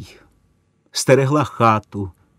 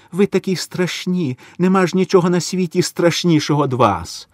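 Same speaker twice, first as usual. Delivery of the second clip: high, trembling with fear; frightened plea; 7 semitones higher than usual. A narrator's reading. Mother tells German soldier she was afraid, high and frightened. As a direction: answer the German in terror.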